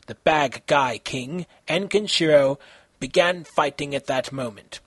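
plain reading voice

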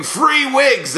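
high-pitched retold holler in character; mock scream. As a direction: throw the pitch way up for a mock yell. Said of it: "I started screaming".

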